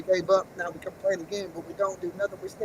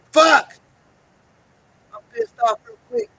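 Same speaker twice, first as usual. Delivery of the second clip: sharp, loud, furious curse; real angry yell; angry shout; 14 semitones higher, 8 dB louder than usual. Real, not acted. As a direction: blurt it out in real frustration. Sudden outburst mid-rant.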